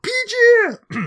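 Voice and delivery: very high, loud Geordie wail; hammed-up Geordie catchphrase scream; mock Geordie accent. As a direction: scream it in anguished broad Geordie. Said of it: "the correct pronunciation of that is".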